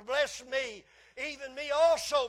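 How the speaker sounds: loud, high, anguished plea from a son; bitter crying plea. Acted. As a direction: cry out to the father in bitter grief. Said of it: "he cried with a great and exceeding bitter cry".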